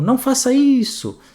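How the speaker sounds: sharp indignant protest; indignant exclamation. Shown as a demonstration. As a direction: scold in disbelief. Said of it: "mudar a sua entonação de voz".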